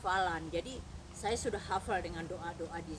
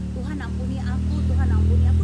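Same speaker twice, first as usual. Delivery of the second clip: loud, high mock-weeping plea; acted desperate repentance; imitated tearful plea; 5 semitones higher, 18 dB louder than usual. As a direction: beg forgiveness loudly as if crying. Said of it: "Terus dia nangis gitu".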